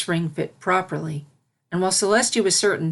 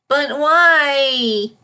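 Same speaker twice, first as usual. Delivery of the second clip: put-on high, louder childish whine; a filly whining at a refusal; 8 semitones higher, 8 dB louder than usual. Filly whining at Celestia's refusal, high and loud. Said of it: "whined the filly".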